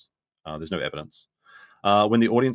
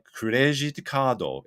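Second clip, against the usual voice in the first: "Credit cardo" drawn out slowly, higher, added vowels; exaggerated Japanese accent; 5 semitones higher, much slower than usual. Shown as a demonstration. Tip for travelers in Japan, "Credit cardo" in Japanese accent. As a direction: stretch "Credit cardo" syllable by syllable, Japanese-style. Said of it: "It sounds really bad".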